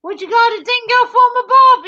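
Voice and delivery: high, loud mock Australian; hammed-up fake Australian question; bad fake Australian. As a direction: squawk it in a terrible Aussie accent. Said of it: "my bad fake Australian imitations".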